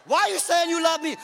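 loud, high demanding question; acted challenge. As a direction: fire it back as a challenge. Acted challenge from puzzled person.

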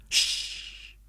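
sharp unvoiced hiss for silence; hissing shush. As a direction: hush him urgently. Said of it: "Velveeen hissed".

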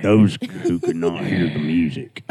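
low, slow put-on voice with jutting jaw; jaw-out impression voice. Shown as a demonstration. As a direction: stick your jaw out and drag it. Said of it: "shake your head and stick your mandible out".

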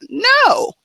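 sharp high loud "No."; flat refusal, loud and high; emphatic no. Real, not acted. Emphatic denial after mock quote.

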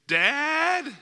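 put-on high, loud child call; scared boy calls out again; boy's call for dad.

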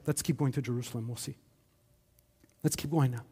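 just murmur onward; quiet calm. Retold or imitated. Jesus quietly urging the disciples onward, low and soft.

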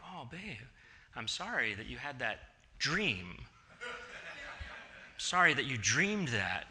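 calm her down gently; soothing apology. Retold or imitated. Husband consoling wife.

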